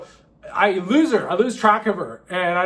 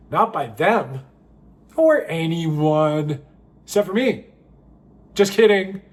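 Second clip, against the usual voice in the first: ordinary talk, nothing marked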